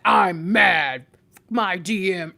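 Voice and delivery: loud, grumpy mock complaint; mock angry. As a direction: huff like someone sulking at their partner. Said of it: "And it's you mad".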